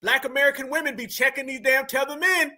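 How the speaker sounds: high, fast excited rant. Real, not acted. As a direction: rant at the audience, wound up. Excited high ranting to audience.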